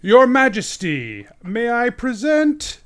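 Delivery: smug herald drawing out each phrase. Acted. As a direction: announce them to royalty with great self-satisfaction. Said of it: "very smug, says".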